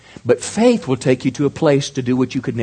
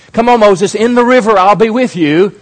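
higher, louder call from God; God commanding; 7 semitones higher, 6 dB louder than usual. Acted. God calling Moses into the river, loud command. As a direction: urge him loudly into the river.

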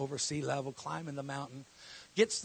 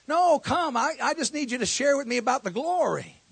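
much higher and louder insistence; raised, pressing appeal; insistent cry; 10 semitones higher, 12 dB louder than usual. Retold or imitated. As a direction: push hard for the answer. Insisting on the glory.

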